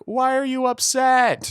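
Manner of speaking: put-on high, drawn-out monotone kid voice; blunt, oblivious child asking out loud; Caleb. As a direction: hold one high note, slowly. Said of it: "You sounded like Caleb off of".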